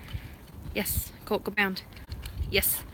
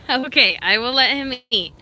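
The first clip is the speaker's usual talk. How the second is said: normal talking voice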